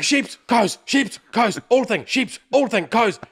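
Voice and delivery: high, loud excited pointing out; excited tourist. Retold or imitated. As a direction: call out every animal she sees. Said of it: "She's like".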